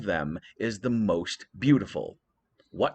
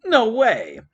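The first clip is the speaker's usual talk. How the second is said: much higher, loud exclamation; shocked Hera; 12 semitones higher, 9 dB louder than usual. Acted. Hera reacting to Athena's whisper, loud shock. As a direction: gasp out disbelief. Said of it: "And Hera said".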